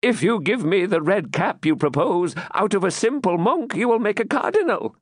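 high, impudent fool's voice; cheeky jester. Acted. Captive jester mocking the baron, high cheeky voice. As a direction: toss off the quip like a jester. Jester jokes facing death.

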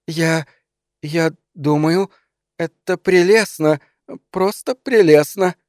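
high, halting strained praise; forced out. A narrator's reading. Wife pretends to like the gift, haltingly forcing praise. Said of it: "выдавила она".

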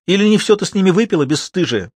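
fast, high sarcastic jab; indignant acted mother; angry scolding. A narrator's reading. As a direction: snap the accusation out quickly. Mother snaps at daughter.

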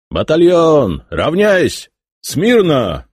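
shouting drill sergeant. Acted. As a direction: shout the drill commands across the square. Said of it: "Пронеслось по плацу". (командный крик на плацу)

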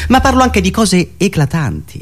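ordinary talk, nothing marked

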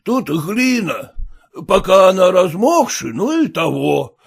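lightly voiced, folksy driver; a hint of a slow-talking peasant. A narrator's reading. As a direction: explain with drawn-out words, like a rustic coachman.

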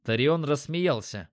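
usual speaking voice

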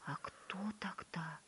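hushed breathless question; whisper. A narrator's reading. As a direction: ask it in a whisper.

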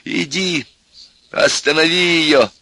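high, drawn-out plea; pleading. A narrator's reading. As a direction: beg him for help. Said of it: "взмолился профессор".

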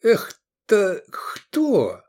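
high, very slow, woozy question; groggy slurring. A narrator's reading. Dazed gladiator eyeing corpses, slow groggy question. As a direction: struggle to get the words out. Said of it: "выговорил он".

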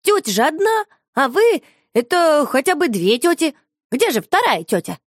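put-on high, bright child voice; puzzled little girl. A narrator's reading. Shusha puzzles over the lady, high childlike voice. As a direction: reason it out like a small puzzled girl.

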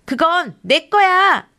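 slow, high claiming cry; shouting. Acted. Girl diving into the bush to claim the acorn, loud shout. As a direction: grab it with your voice. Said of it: "밀리가 덤불 속으로 뛰어들며 외쳤어요".

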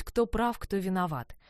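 ordinary delivery

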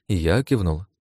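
ordinary delivery, nothing marked

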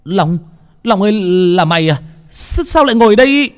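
high, rising cry to a friend; startled shout. A narrator's reading. Phong on the roof spots his missing friend Long, startled shout. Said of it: "nghe tiếng của thằng Phong ở trên hét lên".

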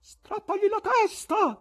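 high, loud, slow stern call; severe voice. A narrator's reading. Gallery spectator answering what to do with the presenter, severe call. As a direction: hand down a harsh order from the gallery. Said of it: "disse una voce severa dal loggione".

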